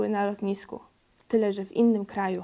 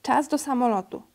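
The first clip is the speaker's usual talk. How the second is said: ordinary delivery, nothing marked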